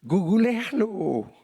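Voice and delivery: high, slow-stretched Spanglish; Mexican Spanglish. Retold or imitated. Preacher jokes about Mexicans' speech, drawn-out Spanglish. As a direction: mimic Mexican Spanglish, dragging the word out. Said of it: "todo lo hacemos spanglish".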